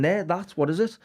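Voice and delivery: put-on a city kid seeing horse dung for the first time, shocked; mock shocked kid. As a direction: sound amazed at something strange. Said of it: "total shock on his face".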